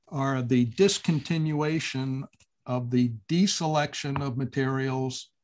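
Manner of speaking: normal talking voice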